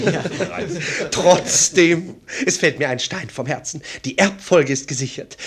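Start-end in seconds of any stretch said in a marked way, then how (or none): 0.1-2.2 laughing. Acted. Laughs at minister's joke.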